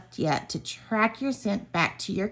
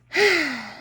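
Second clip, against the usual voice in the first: a short sigh, pitched higher; a little dragon's sigh, higher than the narration; 7 semitones higher than usual. Acted. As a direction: let out one small sigh. Dragon sighs before Skippy's reply.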